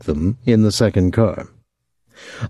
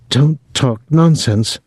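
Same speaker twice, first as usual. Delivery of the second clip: higher-pitched, sharp rebuke; 6 semitones higher than usual. A narrator's reading. Cook dismissing the boy's wild plans, sharp. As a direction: cut him off sharply. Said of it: "she said sharply".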